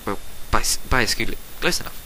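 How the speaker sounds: plain reading voice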